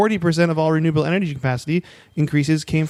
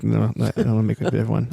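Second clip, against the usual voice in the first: ordinary talk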